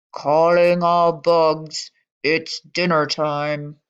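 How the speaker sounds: a slow, loud call to the bugs. Acted. Frogmouth calling all bugs to dinner, slow loud call.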